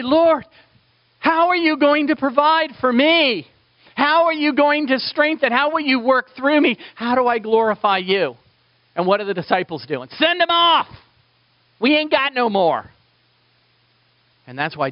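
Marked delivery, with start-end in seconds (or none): 0.0-8.4 high, loud pleading prayer; preaching at full force, pleading; fervent prayer. Acted. 10.1-12.9 higher, louder, a hint of fed-up disciples; lightly voiced frantic followers; exasperated disciples.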